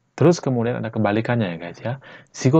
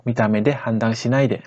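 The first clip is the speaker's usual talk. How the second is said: faster, natural-speed Japanese; sped up. Shown as a demonstration. Teacher repeats the phrase at natural speed for learners. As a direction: run the phrase together quickly. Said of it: "Jadi kalau kita percepat".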